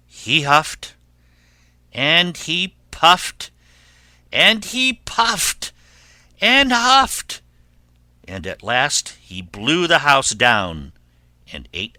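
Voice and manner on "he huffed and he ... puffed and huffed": loud, slow, climbing higher each phrase; building drama. A narrator's reading. Narrator telling of wolf's efforts, rising drama. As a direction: build pitch and force to a peak.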